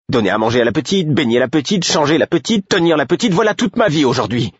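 high voice climbing with each grievance; exasperated tirade. A narrator's reading. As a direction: build from irritated outburst to high, relentless rant. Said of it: "Sa voix était montée d'un cran à chaque phrase". (tirade exaspérée, de plus en plus aiguë)

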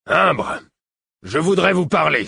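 loud, high-pitched call over the guard; angry demand. Acted. Furious father calls past the guard to Imbre, loud demand.

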